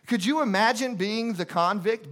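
normal talking voice

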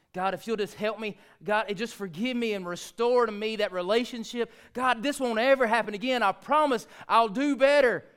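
rising, loud desperate pleading with God; a pleading prayer building to a cry. Retold or imitated. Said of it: "went to God and said".

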